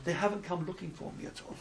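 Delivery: very soft, understated heartbreak; quietly hurt child. Acted. Boy tells rebbe nobody came looking, quietly hurt. Boy's sad punchline.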